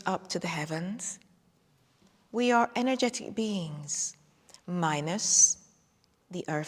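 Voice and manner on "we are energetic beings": ordinary talk, nothing marked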